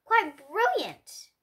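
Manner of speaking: ordinary delivery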